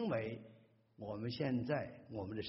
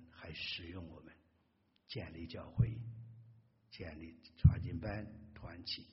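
prayer; 4 semitones lower than usual. Acted. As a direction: pray humbly for the church. Leads closing prayer.

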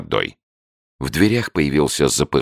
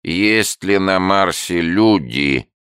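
slow, higher syllable-by-syllable reading; monotone syllables; 6 semitones higher, slower than usual. A narrator's reading. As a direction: drone it out syllable by syllable. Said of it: "тянул по слогам монотонный голос".

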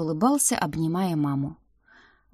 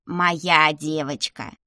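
slow, proudly savoured words; 3 semitones lower, much slower than usual. A narrator's reading. Grandmother praising her granddaughter, proudly. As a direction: draw it out with pride. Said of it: "гордо заявила она".

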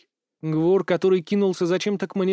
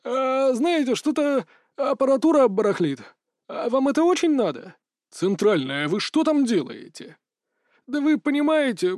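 high, evasive excuse voice; nervous operator; 6 semitones higher than usual. Acted. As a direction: squirm out of the question. (оправдывающийся дежурный)

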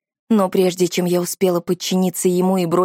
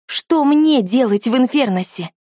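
high, loud panicked question; 6 semitones higher, 3 dB louder than usual. A narrator's reading. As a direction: blurt it out in alarm. Panicked outburst continues.

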